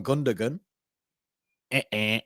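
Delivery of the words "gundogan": Gundogan said with a hard g, "GUN-duh-gun"; common mispronunciation. Retold or imitated. Quoting how some people call him, mispronounced. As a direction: sound the second g in Gundogan. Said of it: "some people call him".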